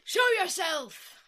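slow, loud, booming wizard command "Show yourself!"; Gandalf command. Retold or imitated. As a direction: call out like Gandalf returning.

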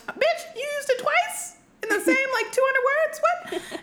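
shrill high exasperated squeal; mock outrage. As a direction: scold the author in a mock high voice. Mocking author's repetition.

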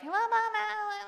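high, teasing la-la; mocking sing-song.

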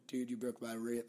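mumbled stab at British; attempted British accent. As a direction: try a British accent and barely manage it. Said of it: "I don't know how to do a British accent".

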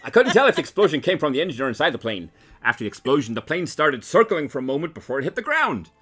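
put-on old-timey mid-Atlantic newsreel voice; mid-Atlantic accent. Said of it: "Is this, like, mid-Atlantic accent?".

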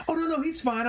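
very high, whiny mock of the dog owner; mocking dismissive guy. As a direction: parody him as clueless and dismissive. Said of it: "And he was like".

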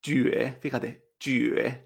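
jue drawn out as "jüe"; slow jue with French U gliding to E; U con diéresis. Shown as a demonstration. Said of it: "aunque no tenga los dos puntitos". (jue con U francesa)

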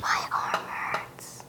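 whispered aside, unvoiced; whispering. Real, not acted. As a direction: breathe it without voice. Puppeteer complains under breath.

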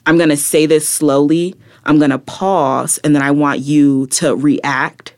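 slow, deliberate phrases with pauses between; slow spoken word. Shown as a demonstration. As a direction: say it slowly, then pause for a reaction. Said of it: "spoken word to me is much more".

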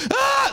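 high imitated baby scream; screaming infant. Dad mimicking his bitten baby, screaming. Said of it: "He's like".

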